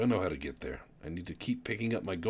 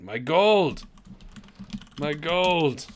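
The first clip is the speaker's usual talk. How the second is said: mock anguished wail; 7 semitones higher, much slower than usual. Player losing gold into space, hammed-up wailing. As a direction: wail for your lost treasure, hammed-up.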